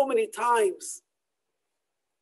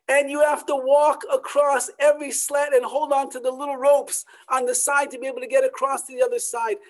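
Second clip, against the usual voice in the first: ordinary talk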